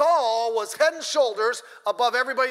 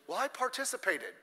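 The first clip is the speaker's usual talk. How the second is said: a lightly mocking loser's excuse, softer; 8 dB quieter than usual. Pastor mimics a sore loser's excuse, softly mocking. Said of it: "It stinks".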